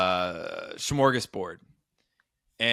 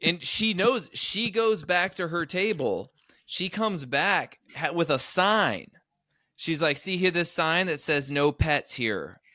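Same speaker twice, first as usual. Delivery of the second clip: mocking retelling; 6 semitones higher than usual. Mocking retelling, raised pitch.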